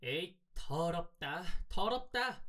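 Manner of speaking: put-on high, drawn-out girl's voice; slow, high-pitched disgust, climbing on the repeat. A narrator's reading. Girl flinging the hen at the boy, disgusted.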